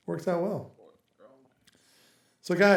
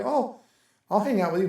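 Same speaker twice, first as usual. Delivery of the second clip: higher, coaxing retold offer; pitched-up, playful coaxing; mock eager offer; 6 semitones higher than usual. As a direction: brightly act out offering company to a child. Acting out himself offering to child.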